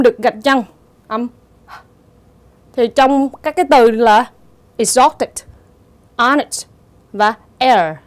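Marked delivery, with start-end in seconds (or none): 4.8-6.7 "exhausted, honest" with no h sound; silent H. Shown as a demonstration. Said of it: "Thì cái âm đó là âm câm". (đọc "exhausted, honest" với âm h câm)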